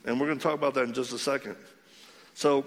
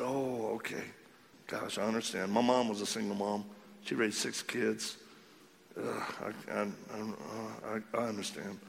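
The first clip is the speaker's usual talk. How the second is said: choked up; 4 semitones lower, 7 dB quieter than usual. Real, not acted. Preacher moved by single mom.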